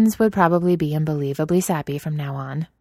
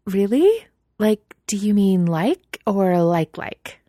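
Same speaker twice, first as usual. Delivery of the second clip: excited; 5 semitones higher, 4 dB louder, slower than usual. A narrator's reading. Mina thrilled by crush news.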